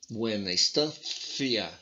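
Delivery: slow "Buena estancia" with the c as a th; lisped Spanish. Shown as a demonstration. Teacher showing lisped Spanish, slow and exaggerated. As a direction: lisp "Buena estancia" slowly, tongue between the teeth. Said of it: "falar com a língua presa".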